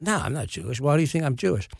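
imitated Cockney bafflement; Michael Caine. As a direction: protest quietly in a rough London star impression. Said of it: "This is a terrible Michael Caine impression".

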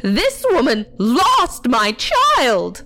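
high, slow, forceful wail; drawn-out outraged cry; hysterical accusation. A narrator's reading. Aunt accuses worker to parents, hysterical.

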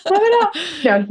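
slow, high, laughing Italian outburst; Italian guy, laughing. Retold or imitated. Wife imitating her Italian husband, laughing exasperation. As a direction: play the exasperated Italian husband through giggles. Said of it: "an Italian guy being like".

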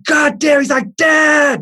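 high-pitched exasperated yell; retold outraged shout, high; mock mad kid. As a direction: imitate a son furious at Dad. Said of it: "he was so mad at me".

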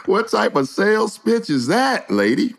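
high incredulous laugh into the question. Real, not acted. Mocking terrible sales pitch.

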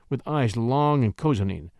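ordinary delivery

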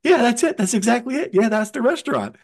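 high, eager yeah that's it; light up as the name clicks; excited recognition. Retold or imitated. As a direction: voice jumps up with recognition. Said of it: "Willard's eyes open wide, goes".

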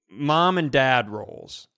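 ordinary delivery

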